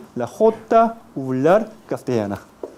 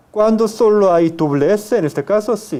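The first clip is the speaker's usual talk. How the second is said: normal talking voice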